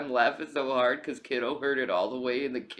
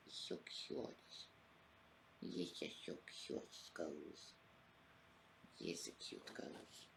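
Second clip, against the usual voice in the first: soft whisper to the sleeping cat; 9 semitones lower, 20 dB quieter, slower than usual. Real, not acted. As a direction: breathe the words to the cat.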